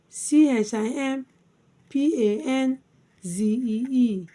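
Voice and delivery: lifted letter groups, settling on the last; spelled by syllables. Shown as a demonstration. Teacher spelling chimpanzee for learners, syllable by syllable. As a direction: recite the letters in three separated chunks. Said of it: "chimpanzee divided into three syllables".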